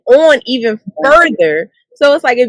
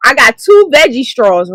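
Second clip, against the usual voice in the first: usual speaking voice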